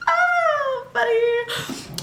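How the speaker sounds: imitating the smug girl; mocking classmate. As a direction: tease her in a high sing-song voice. Girl mocking her in office.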